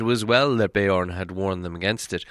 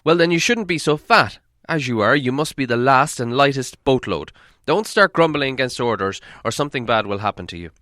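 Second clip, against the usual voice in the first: loud, stern dwarf rebuke; scolding dwarf leader; 4 semitones higher, 5 dB louder than usual. Acted. Dwarf leader scolding the fat dwarf, loud stern. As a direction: tell him off firmly.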